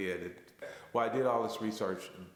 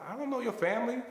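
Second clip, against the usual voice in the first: much higher, louder, disbelieving; incredulous protest; 14 semitones higher, 4 dB louder than usual. Retold or imitated. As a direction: balk at the idea. Incredulous reply to invitation.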